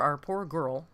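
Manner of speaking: ordinary talk, nothing marked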